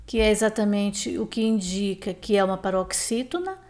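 ordinary talk

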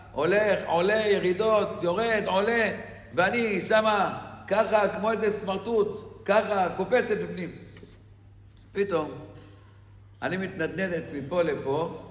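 ordinary talk

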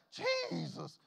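high startled yelp of "Jesus"; startled cry. Pretend. Opening the door to dead Uncle Joe, a startled high cry. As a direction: cry out in sudden fright.

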